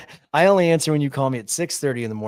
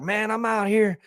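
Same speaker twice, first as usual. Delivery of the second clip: put-on higher, slow drunk rambling; mocking a friend's drunk dawn call, higher and slow; drunk buddy; 7 semitones higher than usual. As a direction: lazily ramble like a drunk pal. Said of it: "And you're like".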